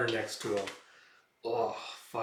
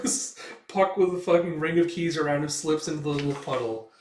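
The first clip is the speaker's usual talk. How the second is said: ordinary talk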